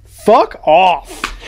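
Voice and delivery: short sharp snap; snapping at himself. Retold or imitated. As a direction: bark it at yourself. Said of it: "just went".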